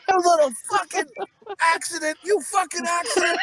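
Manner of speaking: high-pitched hammed-up tirade; mock fury. Co-host voices Slapdog berating the kid, mock fury. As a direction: hurl insults in pretend rage, voice way up. Acting out abusing the child.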